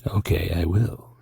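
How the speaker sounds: creepy whispered reply; mystery man whisper. Acted. Told to go on as the mystery man, a creepy whisper. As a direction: whisper it like the sinister stranger. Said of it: "tell me more about the mystery man".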